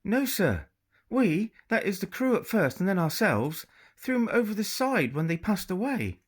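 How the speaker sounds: put-on girl faking an English upper-class accent to a pirate. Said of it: "in her best English upper-class accent".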